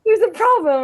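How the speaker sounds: high, laughing mock alarm; laughing mock panic. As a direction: squeal it out through laughter. Laughing retelling of panic moment.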